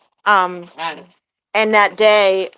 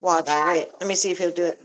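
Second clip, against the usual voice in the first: ordinary talk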